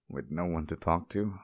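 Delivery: usual speaking voice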